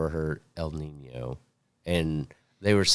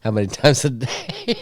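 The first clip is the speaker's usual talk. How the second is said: incredulous; 5 semitones higher than usual. Retold or imitated. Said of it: "I was like".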